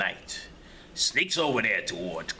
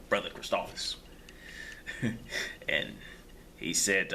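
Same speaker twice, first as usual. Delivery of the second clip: ordinary delivery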